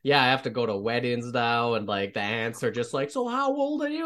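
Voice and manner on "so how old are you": high mock auntie voice; nosy aunt. Aunt at a wedding asking his age, high mock voice. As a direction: pitch way up like a prying aunt.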